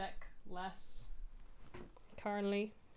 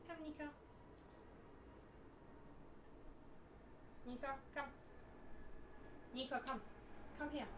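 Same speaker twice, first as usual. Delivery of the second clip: calling the cat; 5 semitones higher, 16 dB quieter, much faster than usual. Real, not acted. Calling her other cat.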